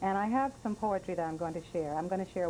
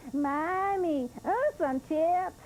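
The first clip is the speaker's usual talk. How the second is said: put-on little girl; 9 semitones higher, 4 dB louder, much slower than usual. Storyteller voices begging little girl.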